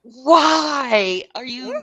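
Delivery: slow, pleading disbelief at being crowded; exasperated plea. Acted. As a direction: stretch it out in loud exasperation. Exasperated at the intruding woman.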